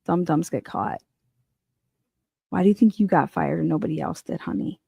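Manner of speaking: usual speaking voice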